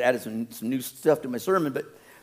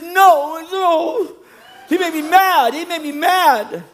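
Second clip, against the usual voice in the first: put-on high, stubborn childish protest; sulky child; 12 semitones higher, 5 dB louder, much slower than usual. Child refusing the pastor's seat, high whine. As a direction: whine it like an offended kid. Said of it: "It's the children do".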